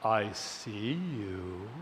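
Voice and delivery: low, slow menacing killer voice; a slow, low, threatening whisper down the phone; creepy caller. Retold or imitated. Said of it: "And he says".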